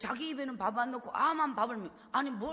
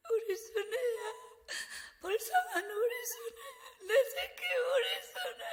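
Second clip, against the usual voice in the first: high, loud, tearful cry of her daughter's name; mother crying; 11 semitones higher, 3 dB louder than usual. Retold or imitated. Mother weeping over her little daughter, high and tearful. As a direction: sob it out, voice breaking high. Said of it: "하면서 엄마 혼자 막 울어요".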